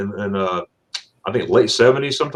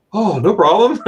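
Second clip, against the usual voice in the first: happily agreeing wife; 9 semitones higher, 4 dB louder than usual. Retold or imitated.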